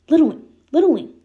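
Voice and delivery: loud, high calling out of a name; worried calling. Acted. Dick calling to his unconscious brother, loud and high. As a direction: shout to wake him, worried.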